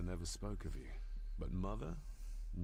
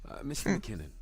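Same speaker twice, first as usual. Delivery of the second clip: awkward correction; 6 semitones higher, 9 dB louder than usual. Acted. Character corrects himself, awkward.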